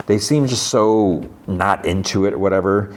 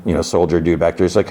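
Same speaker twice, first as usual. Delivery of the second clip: ordinary talk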